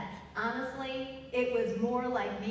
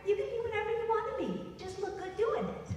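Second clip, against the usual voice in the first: higher, rushed blunt mock mother; lightly voiced blunt German mom; 9 semitones higher, faster than usual. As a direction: rattle it off, then the dry twist. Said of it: "My German mother".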